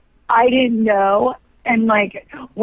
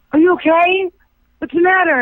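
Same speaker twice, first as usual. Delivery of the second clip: concerned director; 8 semitones higher than usual. Retold or imitated. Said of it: "He goes".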